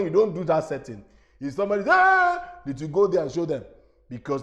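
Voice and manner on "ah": loud, high "ah" yell; sudden drawn-out cry, pitched way up; imitated outburst. Imitating a noisy participant cutting into the meeting, loud high yell.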